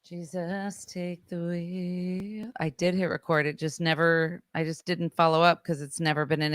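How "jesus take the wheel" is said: softer, slow resigned mutter; hushed defeated plea. Real, not acted. Host finds the card is full, murmuring in soft defeat. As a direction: give up, hand it over quietly. Despair at lost recording.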